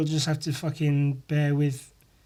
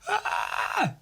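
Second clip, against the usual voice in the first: a high strained scream that barely comes out; scream attempt. Real, not acted. Vlogger testing whether he can still scream, failing scream. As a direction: try to scream but it fails. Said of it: "I'm trying to scream".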